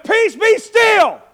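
slow, loud, high command; Jesus commanding the storm. Acted. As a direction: hold each word like an order to the wind. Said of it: "and he goes".

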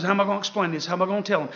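worried murmur. Retold or imitated. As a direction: mutter it like Mary fretting on the road. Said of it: "She goes".

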